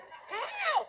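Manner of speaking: high pointed cry; single him out sharply; calling out. Retold or imitated. Speaker accusing a listener from the stage, high and sharp. Speaker calls out audience member.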